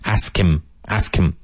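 "Ask him" run together like "ass Kim"; ask-Kim linking. Shown as a demonstration.

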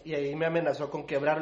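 ordinary talk

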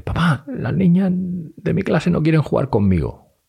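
put-on high, slow childlike whine; imitating a child confiding in papá; little daughter. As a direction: put on a small girl's plaintive voice. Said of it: "me viene y me dice".

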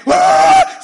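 high, loud imitated howl of barking dogs; frenzied dog barking. Preacher mimicking eight panicked dogs, loud high howl. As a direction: mimic a whole pack going off. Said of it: "Todos ladrando".

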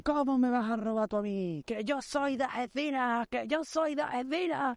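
high, drawn-out mock shout; indignant high wail, stretched out; retold hysterical yelling. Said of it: "empiezo a gritar por mitad de San Sebastián".

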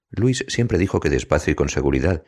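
plain reading voice

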